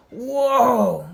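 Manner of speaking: loud, high exclamation of wonder; amazed whoa. Real, not acted. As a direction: gasp it out in amazement. Characters burst from book.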